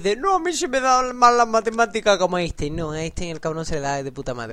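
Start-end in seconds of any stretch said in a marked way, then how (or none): 0.2-2.7 higher-pitched mocking voice of an excuse-maker; hammed-up poor-student whine. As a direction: put on a high, silly protesting voice. Mocking people's excuse about maths.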